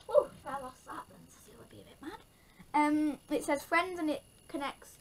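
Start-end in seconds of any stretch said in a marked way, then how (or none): 0.2-2.2 quick unvoiced whisper. Real, not acted. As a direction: whisper it quickly under your breath. Imagined trouble with friend.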